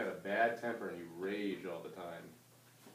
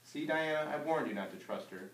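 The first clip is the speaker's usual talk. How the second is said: triumphant; 6 semitones higher, 5 dB louder than usual. Acted. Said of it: "Georgie cried in triumph".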